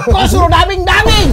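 high, loud laughing disbelief; laughing protest. Real, not acted. To the director, laughing protest about dubbing. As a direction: laugh out the question of why dub.

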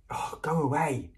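high, exasperated whispered brush-off; exasperated half-whisper; irritated dismissal. A narrator's reading. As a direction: shoo them off in an irritated whisper. Ron brushes off scolding Hermione.

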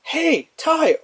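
high, bright-pitched call; high cartoon sidekick greeting; cartoon partner voice. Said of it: "they can actually say, like".

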